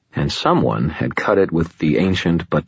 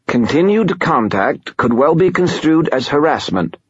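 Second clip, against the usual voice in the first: wheezy nasal voice; 10 semitones higher than usual. Acted. Glau warns Murphy.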